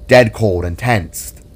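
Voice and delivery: ordinary delivery, nothing marked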